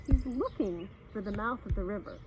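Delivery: usual speaking voice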